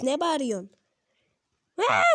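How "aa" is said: short character shriek; one abrupt wordless yell; Mortis scream. Acted. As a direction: cry out in character. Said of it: "Ula oğlum bağırtma beni".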